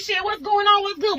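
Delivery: high alarmed question. Retold or imitated. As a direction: ask it in a rising panic. Confused mid-fight calling out.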